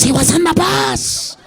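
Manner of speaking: a Puerto Rican guy repeating where he saw it; Puerto Rican accent. Retold or imitated. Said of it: "Puerto Ricans are gonna be like".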